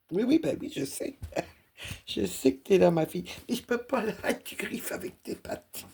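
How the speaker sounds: high-pitched cooing at the cat; high sing-song voice for a pet; pet talk. Real, not acted. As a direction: coo at your cat as she paws at you.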